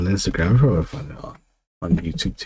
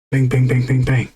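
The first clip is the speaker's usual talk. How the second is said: knocking sound; 5 semitones higher, 4 dB louder than usual. Retold or imitated. Dad knocking on the door.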